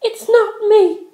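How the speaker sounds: high, detached denial; high voiced girl, unbothered; Penelope's voice. A narrator's reading. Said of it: "Penelope denies, voiced character".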